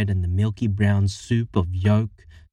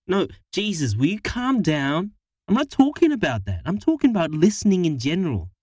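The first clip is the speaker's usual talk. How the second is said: exasperated; 9 semitones higher than usual. Acted. Harriet snaps back.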